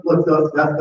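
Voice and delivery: normal talking voice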